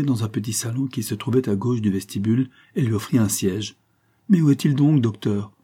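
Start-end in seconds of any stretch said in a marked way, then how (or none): 4.3-5.5 higher, louder impatient demand; urgent, fretful question; feverish impatience. A narrator's reading. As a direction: press the doctor impatiently, starting high and loud. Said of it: "avec une impatience fébrile".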